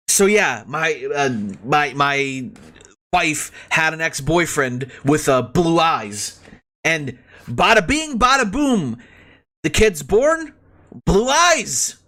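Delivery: a light, mock Italian American accent. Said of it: "do an Italian American accent".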